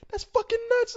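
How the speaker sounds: high incredulous. Retold or imitated. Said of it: "I was like".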